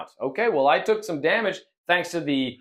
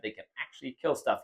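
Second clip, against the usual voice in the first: ordinary talk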